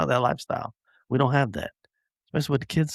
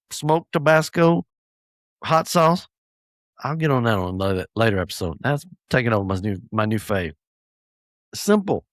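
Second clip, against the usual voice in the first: ordinary delivery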